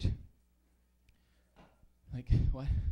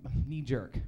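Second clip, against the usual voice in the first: normal talking voice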